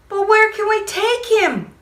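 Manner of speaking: put-on high anxious child voice; worried child. Boy asks firemen, high worried child voice. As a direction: ask like a fretful boy. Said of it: "I asked".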